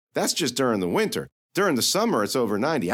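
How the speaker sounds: put-on teasing girl voice, pitched high; sassy young girl. Lily needling the knight about heat, teasing. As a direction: poke fun at the knight's logic.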